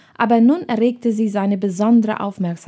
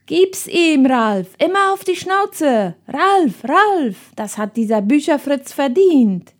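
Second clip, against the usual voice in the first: high excited shouting from the crowd; kids egging on; 5 semitones higher than usual. Acted. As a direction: schoolyard crowd yelling for Ralf. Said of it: "Erste Rufe wurden laut".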